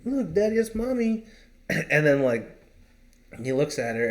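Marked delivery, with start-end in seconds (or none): 0.0-1.2 put-on high child's voice; little daughter's voice. Daughter pointing her mom out to her dad, high child voice. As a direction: pitch up into a small girl tugging at her dad. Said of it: "And he's like".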